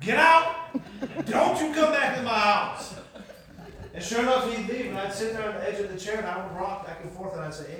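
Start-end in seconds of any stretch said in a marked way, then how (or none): 0.0-3.0 loud, high angry order; furious shout. Retold or imitated. Dale throwing pastor out of his house, furious shout. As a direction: yell it to drive him out, drawing out the end.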